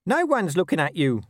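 high, plaintive protest; mildly desperate high whine; wailed. A narrator's reading. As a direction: wail it as a hounded underling. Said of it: "wailed Nobby".

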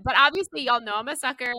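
ordinary delivery

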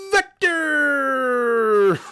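very high, loud, bright bark of the company name; imitating Adam's phone greeting. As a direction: yell the company name like an over-pumped salesman grabbing the phone. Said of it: "so he would answer the phone".

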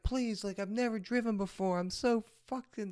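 high-pitched retold begging; pleading. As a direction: beg like a scared student driver. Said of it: "And I was like".

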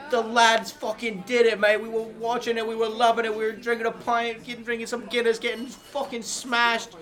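a heartbroken London fan after penalties; hammed-up British lad venting about the loss; mock gutted English fan. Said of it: "[name], what is the mood like".

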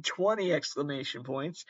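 ordinary talk, nothing marked

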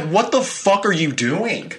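slow, high-pitched incredulous demand; exasperated retelling. Retold or imitated. Scared fiancé to fiancee who slammed something, exasperated. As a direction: stretch it out in high mock outrage. Retold outburst after second scare.

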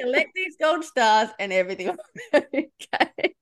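high, amused sing-out into laughter; laughing mock reassurance. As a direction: promise it playfully, breaking into a laugh. Amused at inner part's rules.

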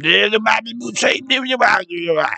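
imitating the Waterboy character; Waterboy mumble. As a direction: mumble like no teeth. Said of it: "like that dude from Waterboy".

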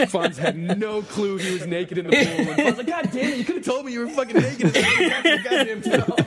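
can barely finish for laughing; laughing hard. Real, not acted. Host laughing while telling the naked pool punchline.

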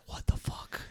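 whispered in disbelief, barely voiced; awed whisper. Recalling watching the fight, whispering in awe. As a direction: breathe it out like you can't believe your eyes.

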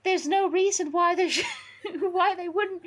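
high, put-on lecturing voice, a laugh breaking in; Harry. Harry reasoning out a conspiracy, high character voice. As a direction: play a high-voiced little theorist, nearly cracking up.

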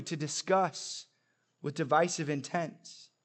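ordinary delivery

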